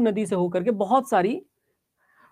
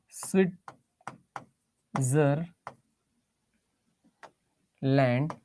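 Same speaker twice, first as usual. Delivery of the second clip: very slow, broken into three separate syllables; 5 semitones lower, 6 dB quieter, much slower than usual. Real, not acted. Teacher writing the country's name in Hindi, Switzerland drawn out syllable by syllable. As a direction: say Switzerland slowly in pieces, with long gaps between syllables.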